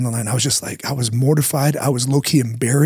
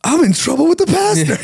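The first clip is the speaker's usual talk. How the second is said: put-on much higher voice of a frightened eleven-year-old; scared kid; 13 semitones higher than usual. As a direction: sound like a child caught out. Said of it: "I'm, like, eleven years old".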